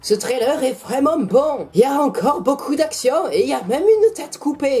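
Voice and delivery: high-pitched put-on German accent; mock German accent. Shown as a demonstration. YouTuber reviewing the trailer for laughs, mock German accent. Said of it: "Je préfère utiliser l'accent allemand".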